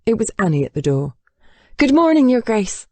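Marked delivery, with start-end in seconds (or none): 1.8-2.9 high-voiced nervous maid. A narrator's reading. Maid greeting the bare-chested Duke, high and nervous. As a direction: greet a master who unsettles you. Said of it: "the maid sounded nervous".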